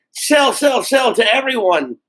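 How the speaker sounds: high-pitched, mocking sales chant; mock hard sell. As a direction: parody a relentless sales pitch.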